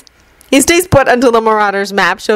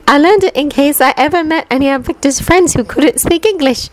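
put-on higher, brisk know-it-all girl explaining; a quick, slightly defensive schoolgirl; Hermione's voice; 7 semitones higher than usual.

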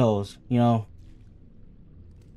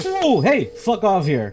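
startled yell; 9 semitones higher, 7 dB louder, slower than usual. Real, not acted. Startled by creature in game.

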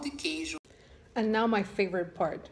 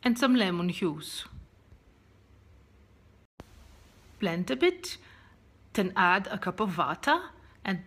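put-on Dutch accent with throaty G sounds; Julia; 4 dB louder than usual. Dutch friend teaches vegan hollandaise, strong Dutch accent. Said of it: "tagged me to do a Dutch accent".

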